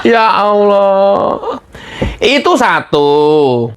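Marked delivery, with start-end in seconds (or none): 0.0-1.6 laughing disbelief. Real, not acted. Host laughing in disbelief at JK's words. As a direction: laugh helplessly at it.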